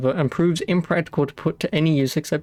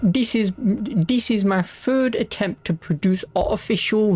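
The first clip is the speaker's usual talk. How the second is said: put-on raised, slow ye olde Victorian accent; 5 semitones higher than usual. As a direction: read it like a Victorian gentleman. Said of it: "my ye olde Victorian accent".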